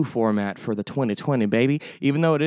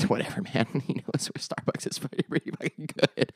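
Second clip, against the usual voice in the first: breathy laugh through the words, much softer; half-laughed admission; laughing while talking; 7 dB quieter than usual. Real, not acted. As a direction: chuckle it out under your breath. Laughing at himself about Starbucks.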